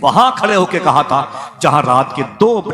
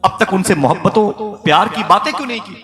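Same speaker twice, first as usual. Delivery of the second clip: higher, louder fervent reproach; impassioned preaching; 6 semitones higher, 4 dB louder than usual. Acted. Preacher scolding the congregation over neglected neighbours, fervent and raised. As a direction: build the rebuke to a pulpit climax.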